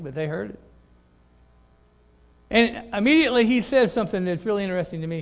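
ordinary talk, nothing marked

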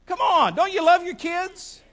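rousing challenge. Real, not acted. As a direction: push the congregation for an answer. Pressing congregation for a louder response.

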